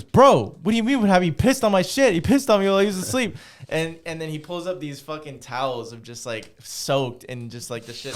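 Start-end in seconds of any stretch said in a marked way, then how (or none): 0.0-3.4 higher, louder indignant bro; retold outraged friend. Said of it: "He goes".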